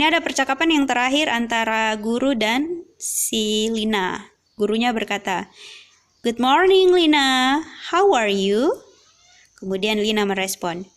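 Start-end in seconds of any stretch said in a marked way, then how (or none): 6.2-8.7 lightly voiced teacher. Teacher greets pupil in dialogue.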